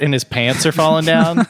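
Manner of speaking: ordinary talk, nothing marked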